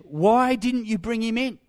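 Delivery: acted indignant interrogation; demanding. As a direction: demand answers from the failed guards. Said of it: "leading priests and Pharisees demanded".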